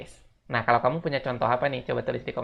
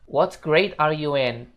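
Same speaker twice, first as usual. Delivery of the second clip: model sentence; 3 semitones higher, 3 dB louder, much slower than usual. Shown as a demonstration. Teacher introduces the class question slowly. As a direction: read it slowly and clearly. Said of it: "kita lanjut".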